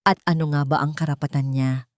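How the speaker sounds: ordinary delivery, nothing marked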